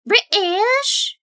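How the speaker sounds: high, loud puzzled query; surprised question. Real, not acted. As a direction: ask in disbelief.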